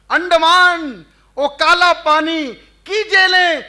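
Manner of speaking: slow declamation building to a loud slogan; rousing poem recitation. Acted. Poet saluting Veer Savarkar before a crowd, rousing recitation. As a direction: draw out the verse, then ring out the salute.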